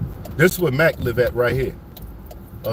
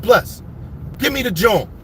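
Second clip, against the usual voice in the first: hyped up; 6 semitones higher than usual. Retold or imitated. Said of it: "I'm hyped up".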